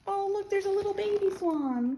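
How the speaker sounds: put-on high cooing at a baby animal; high-pitched adoring discovery; cooing delight. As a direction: coo sweetly to small children. Cooing over picture of baby swan.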